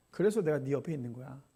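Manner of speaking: low, soft answer of God; God's gentle answer. Retold or imitated. God answering the complaining pastor, low and soft. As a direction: quietly reassure him he is not alone. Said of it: "하나님께서 뭐라 말씀하시는 줄".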